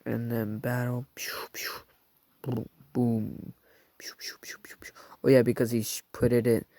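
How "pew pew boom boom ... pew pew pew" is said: whispered pew-pew blaster sounds; whispered gun noises. Acted. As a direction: mouth the shots in a whisper.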